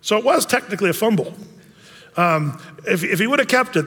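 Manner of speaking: ordinary delivery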